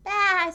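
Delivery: high, loud baa; sheep baa. Acted. Sheep left on the ground, a high loud baa. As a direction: bleat it loudly. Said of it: "said Sheep".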